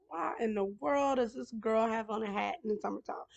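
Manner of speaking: ordinary talk, nothing marked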